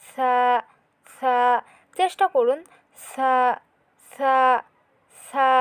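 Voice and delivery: সা said thin, tongue tip touching the tips of the upper front teeth; a light, thin সা with the tongue tip on the teeth; lisped Arabic tha sound for students. Shown as a demonstration. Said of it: "পাতলা করে পড়তে হবে".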